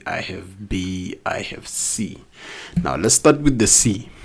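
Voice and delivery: ordinary talk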